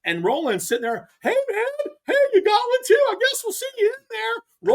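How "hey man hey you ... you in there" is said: very high-pitched mocking taunt; hammed-up teasing impression, pitch way up; Roland Martin. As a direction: needle a rival in a squeaky put-on voice. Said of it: "Roland's chucking me up, like, laughing me up".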